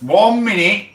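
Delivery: high, loud shout across the room; loud call. Real, not acted. Streamer shouting to someone off-mic, loud call. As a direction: yell it to someone in another room.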